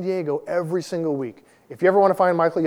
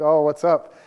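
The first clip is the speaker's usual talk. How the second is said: louder, showy greeting, playing it cool; acted cool swagger; 5 dB louder, slower than usual. Young airman landing home in his flight suit, mock cool swagger. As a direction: toss it out loud with mock swagger. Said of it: "I remember just being so cool".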